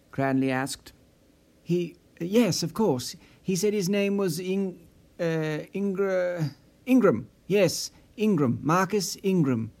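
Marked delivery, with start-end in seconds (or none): 1.6-9.7 halting, stammering nervous answer; flustered, hesitant recall of a name. A narrator's reading. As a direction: fumble for the name under pressure. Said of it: "Nissen relayed nervously".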